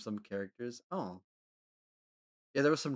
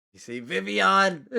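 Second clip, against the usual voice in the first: mock indignant; 7 semitones higher, 9 dB louder, slower than usual. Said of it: "and it's like".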